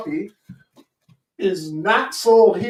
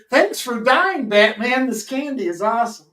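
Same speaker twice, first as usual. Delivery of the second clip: mock grateful kid; 6 semitones higher than usual. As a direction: thank him like a cheerful child. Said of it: "Since it's Easter".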